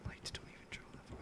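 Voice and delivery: quick, hushed whisper; tense whisper. Real, not acted. A player sneaking past the monster, whispering tensely. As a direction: mutter it under your breath while sneaking. Sneaking through the haunted house.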